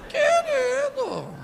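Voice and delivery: very high, long-drawn comic squeal; hammed-up wail, stretching the word out; mock wailing endearment. [name] pleading comically with Zé.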